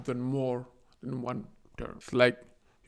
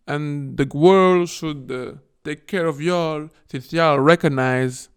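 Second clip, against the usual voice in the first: louder, slower scolding; loud, slow hammed-up government scolding; mock lecture; 8 dB louder than usual. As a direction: lecture the neighbor condescendingly. Somaliland government voiced lecturing.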